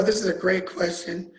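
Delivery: ordinary talk, nothing marked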